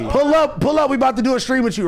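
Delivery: high-pitched, eager call to pull up; excited urging. Real, not acted. Host calling to the streamer to pull up, excited urging. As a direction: urge him over, pitch jumping up. Urging caller to join them.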